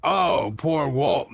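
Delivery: loud, pitying wail for Walt; mock-pitying shout. Real, not acted. Shouting pity for Walt over Jesse's mess. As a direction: shout it like a lament.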